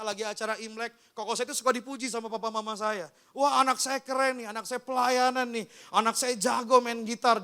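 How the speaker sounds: ordinary talk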